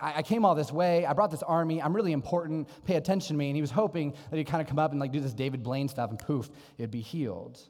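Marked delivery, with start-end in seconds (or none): 0.0-3.5 higher, louder, puffed-up demand for attention; mock the proud general's entitled complaint; self-important Naaman. Pastor mocking Naaman's pride to the congregation, loud self-important whine. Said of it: "what Naaman is saying is like".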